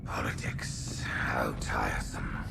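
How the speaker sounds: soft bored whisper to himself; weary whisper. Acted. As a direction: breathe it out, fed up with politics. Prophet's weary aside to himself.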